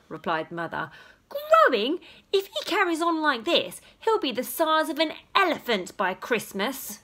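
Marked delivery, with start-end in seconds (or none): 1.3-7.0 snapping. Acted. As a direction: snap back in disbelief. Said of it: "snapped his father".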